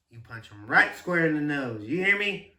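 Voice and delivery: loud imitation of a forceful dad; tough dad's order. Son imitating dad's advice before kindergarten, loud tough-dad order. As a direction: punch out RIGHT, then lift the final question. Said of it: "that's exactly how he said it".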